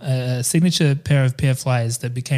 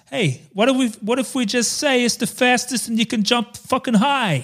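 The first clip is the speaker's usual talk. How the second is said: higher-pitched hammed-up pitch meeting voice; put-on old-time adman, voice raised in pitch; sixties ad man; 9 semitones higher than usual. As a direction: pitch it like a mock ad exec. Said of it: "Why are we talking like this?".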